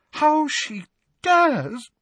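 slow, high indignation breaking out; indignant muttering. A narrator's reading. Professor fuming to herself about her inspector, indignant. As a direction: let the muttered outrage swell, drawn out and high. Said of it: "muttering what sounded like threats under her breath".

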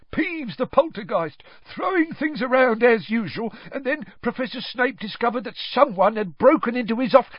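high, loud, eager tattling; Filch. A narrator's reading. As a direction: blurt out the report, pitched very high. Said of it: "said Filch at once".

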